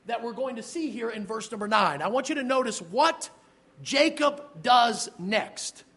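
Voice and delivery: ordinary delivery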